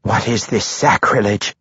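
mildly indignant demand. A narrator's reading. Matron Mother seeing her defiled chapel, mildly demanding. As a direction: demand an explanation, with restraint. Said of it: "Matron Mother Quenthil demanded".

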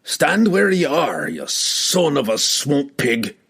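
dwarf threatens the charging Cassius; threatening dwarf. A narrator's reading. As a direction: menace him word by word. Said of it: "was Bruner's threatening response".

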